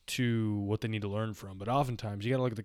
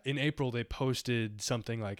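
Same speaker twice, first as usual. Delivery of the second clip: ordinary talk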